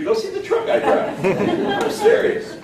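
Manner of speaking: laughing up high while joking about his truck; giggly, high-pitched insistence. Real, not acted. Speaker teasing the audience about his country truck, laughing high.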